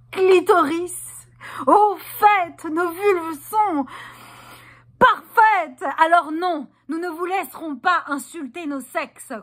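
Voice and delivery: high, ringing, drawn-out proclamation; exalted celebration. Acted. Performer celebrating women's bodies, high and exalted. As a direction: proclaim it in rising rapture.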